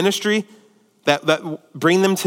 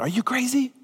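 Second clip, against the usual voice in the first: incredulous disciples; 6 semitones higher, 6 dB quieter than usual. Acted. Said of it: "the other disciples are going".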